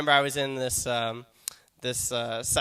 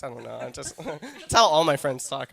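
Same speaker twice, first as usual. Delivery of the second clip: usual speaking voice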